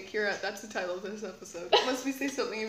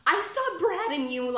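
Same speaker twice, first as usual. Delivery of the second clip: put-on higher, pushier gossiping teen; bitchy schoolgirl voice; 7 semitones higher, 5 dB louder than usual. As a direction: play the catty eighth grader. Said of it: "I really wish I had done that voice".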